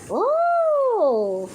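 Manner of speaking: a sudden delighted gasp; surprised oh. Real, not acted. Reacting to the bird.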